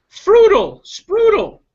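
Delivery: high, loud nonsense squeal; squeaky bird voice; silly bird blurt. Acted.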